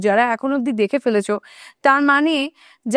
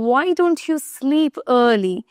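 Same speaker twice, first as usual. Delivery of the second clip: very slow question; slow example; much slower than usual. Shown as a demonstration. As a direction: draw out each word. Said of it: "example slowly". (ধীরে ধীরে প্রশ্ন)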